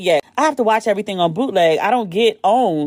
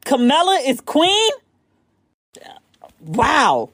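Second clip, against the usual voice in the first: surprised; 5 semitones higher, slower than usual. Real, not acted. Reading that Camilla is queen.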